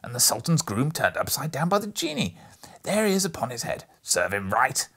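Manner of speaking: put-on high, loud, breathless childlike excitement; between laughing and crying. A narrator's reading. Scrooge gushing over storybook heroes, half laughing, half crying. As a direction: gush with tearful glee. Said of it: "in a most extraordinary voice, between laughing and crying".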